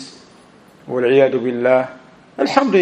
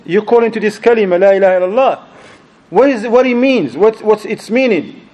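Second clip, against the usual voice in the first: raised pitch, pressing questions; insistent challenge; 5 semitones higher, 3 dB louder than usual. Retold or imitated. Brother pressing a stumped preacher for the meaning, insistent challenge. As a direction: re-enact an exasperated cross-examination.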